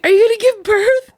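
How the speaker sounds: very high, loud mock-alarmed question; worried child. Daughter fears mom is in labor, imitated alarm. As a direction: imitate a panicky little daughter. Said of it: "she has, like, that worried look".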